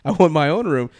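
complaining there's a guy in my room; indignant protest. Retold or imitated. Protesting to the hotel clerk, loud and indignant.

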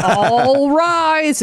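high, drawn-out mock broadcaster call; sportscaster send-up; home run call. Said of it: "What about those, uh, home run calls?".

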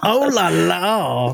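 slow put-on French Oh la la; imitating mock French. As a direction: stretch Oh la la as a lazy Frenchman. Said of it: "He said".

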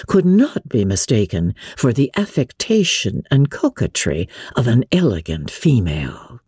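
usual speaking voice

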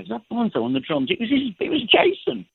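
high-pitched disbelief, retold; amazed recounting. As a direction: relive the astonishment, voice climbing high. Retelling his amazement at child drummer.

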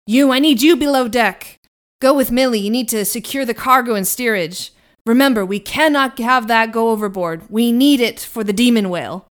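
firm, loud commands to a crew member; stern captain orders. Acted. Captain Ahab orders Bertram below deck, loud and firm.

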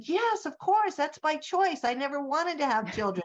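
high-pitched, emphatic retold reply; breezy dismissal. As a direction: re-enact brushing it off as obvious, voice leaping up then easing down. Retelling her breezy reply to translator.

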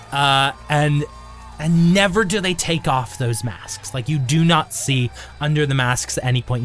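ordinary talk, nothing marked

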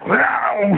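a short battle grunt; fight yell demo. Shown as a demonstration. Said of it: "literally doing that".

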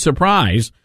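normal talking voice

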